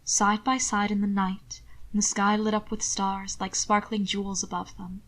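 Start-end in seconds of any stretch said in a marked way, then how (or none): none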